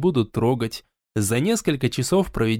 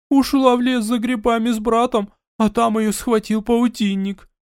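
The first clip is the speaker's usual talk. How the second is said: panicked crying mother; 8 semitones higher, 3 dB louder than usual. Acted. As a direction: burst in wailing with fear. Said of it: "прибежала вся в слезах".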